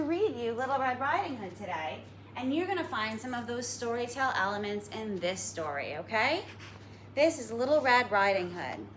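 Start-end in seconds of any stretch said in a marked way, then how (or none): none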